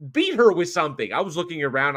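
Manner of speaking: ordinary talk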